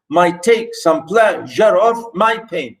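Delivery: "might take some pleasure of my pain" slowly, in separate beats; iambic scansion. Shown as a demonstration. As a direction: split "pleasure" across two beats as you scan. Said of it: "unstressed, stress".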